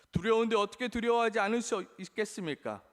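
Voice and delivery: normal talking voice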